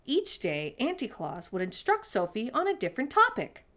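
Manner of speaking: ordinary delivery, nothing marked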